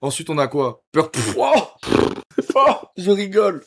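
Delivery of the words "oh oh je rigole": high, theatrical bad-guy gloat; playing a cocky anime villain. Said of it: "Il se prend pour un, pour un personnage de One Piece".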